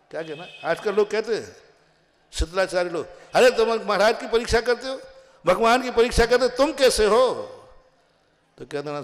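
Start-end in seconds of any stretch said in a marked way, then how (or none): 3.3-7.3 high, rapid-fire mock accusation; challenging. People questioning who examines monk and God, challenging. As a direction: throw the questions at them quickly.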